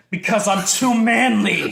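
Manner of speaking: loud, high jokey yell; mock macho shout. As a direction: bellow it like a tough guy. Mock-macho shout after breakage.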